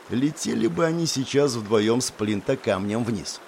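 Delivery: plain reading voice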